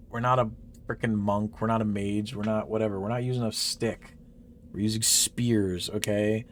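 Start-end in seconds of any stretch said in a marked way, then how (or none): none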